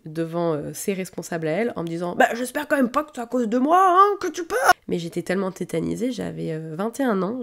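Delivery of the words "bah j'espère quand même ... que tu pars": higher, louder, rising to a sharp bark; imitating barking boss. Workshop boss barking at the intern in front of managers, mock snapping. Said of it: "qui m'aboyait dessus".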